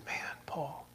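soft whispered address. Acted. Jesus whispering to Saul face to face. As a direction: barely breathe the name.

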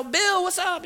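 high-pitched friendly hello; chummy greeting. Retold or imitated. Patient greeting Bill at the clinic, chummy.

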